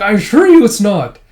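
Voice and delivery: high, loud mock correction; acted firm denial. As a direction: insist against it, playfully exasperated.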